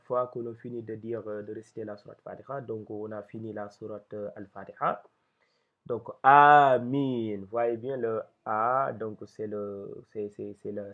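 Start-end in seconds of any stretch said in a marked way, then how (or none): 6.2-7.4 Amin with both vowels stretched slowly and louder; long vowels. Shown as a demonstration. Teacher showing learners the long vowel, Amin drawn out. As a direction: hold the long A in Amin, loud and slow. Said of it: "c'est la voyelle longue".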